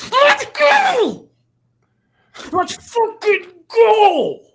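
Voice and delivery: huge high roaring cheer; frat bro. Real, not acted. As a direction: bellow it like a hyped stadium bro. Celebrating a big play.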